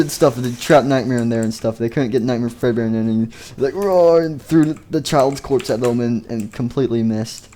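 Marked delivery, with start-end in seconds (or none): 3.7-4.2 put-on a kid's toy-monster roar, pitched up; mock monster roar. Kid voicing Nightmare Fredbear's roar as a mock roar. Monster roaring, throwing corpse.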